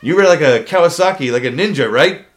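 mock drill instructor to a recruit. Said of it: "He goes".

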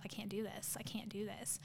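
hushed, half-whispered defeat; inner self-talk. Retold or imitated. Athlete repeating doubts in her head mid-row, hushed self-talk. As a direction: drop to a near-whisper, talking to yourself. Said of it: "keep telling myself".

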